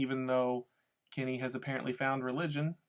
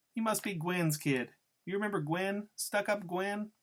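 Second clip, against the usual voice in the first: higher-pitched gossipy woman's voice; Loretta; 7 semitones higher than usual. A narrator's reading. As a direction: lift the voice for a nosy woman digging up old acquaintances. Narrator voices Loretta recalling Gwen.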